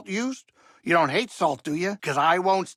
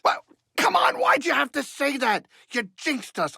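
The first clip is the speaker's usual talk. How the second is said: exasperated whine; 9 semitones higher than usual. Acted. Pilot complains that Cal jinxed them, high exasperated protest. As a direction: pitch leaps way up in mock outrage.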